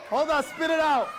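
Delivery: ordinary delivery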